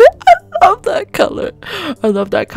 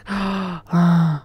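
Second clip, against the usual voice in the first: long breathy gasp; awed gasp. Real, not acted. Gamer looking at the Ferrari, gasping. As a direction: gasp in wonder.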